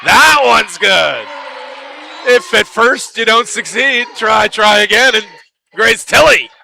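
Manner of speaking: high, loud rising call of the made three; excited call. Real, not acted. Commentator calling a big three to fans, excited and loud. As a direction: lift the voice for the big shot.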